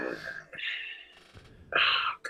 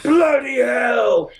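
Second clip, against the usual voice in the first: much higher, shouted, slow posh English; a hammed-up aristocrat exclaiming; posh British impression; 10 semitones higher, much slower than usual.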